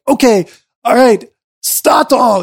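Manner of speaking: put-on high, lightly accented crew voice. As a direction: announce the name brightly. Crew voiced, timbre shifts.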